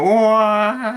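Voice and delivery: high loud Luigi cry. Retold or imitated. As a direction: belt out a cartoon plumber's wah. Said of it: "he did Luigi voice".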